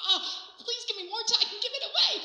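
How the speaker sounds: high, laughing plea; giddy pleading. Acted. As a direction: beg with bubbling excitement. Giddy plea to God, acted.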